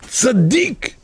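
high pleading cry of a grieving mother; mother's outcry. Acted. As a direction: cry the word out high in protest. Mother cries out over her son. (זעקת אם גבוהה וכואבת)

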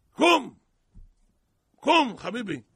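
loud, high "get up!" shout; shouting wake-up. Retold or imitated. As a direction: yell at a sleeping man to rouse him. Sailors waking stranded man.

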